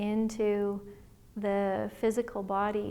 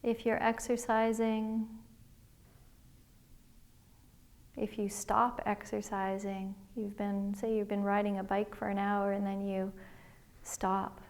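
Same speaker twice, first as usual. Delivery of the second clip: guided meditation. Acted. Liz leading listeners through a body scan, calm guided meditation voice. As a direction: gently guide listeners inward, one breath at a time.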